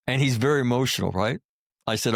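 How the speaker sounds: ordinary talk, nothing marked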